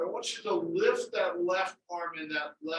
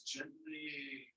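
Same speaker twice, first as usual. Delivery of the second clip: much softer repeat of gently; gentle and soft; 11 dB quieter than usual. Shown as a demonstration. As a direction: breathe the word gently softly.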